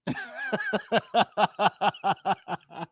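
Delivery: drawn-out run of chuckles; long laugh. Real, not acted. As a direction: laugh at length at your own act. Laughs at his own acted threat.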